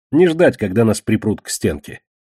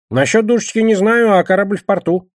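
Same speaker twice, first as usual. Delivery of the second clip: higher-pitched voice for a defensive character; defensive Кегля; 10 semitones higher, 4 dB louder than usual. Acted. Кегля answers questions about the ship, defensively.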